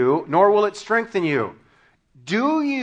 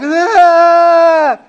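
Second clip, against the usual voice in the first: put-on long, loud, high "aah" of a crying toddler; hammed-up drawn-out cry; mock child's wail; 11 semitones higher, 13 dB louder than usual. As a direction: imitate a kid wailing with a mouth full of sand. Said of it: "and he's crying".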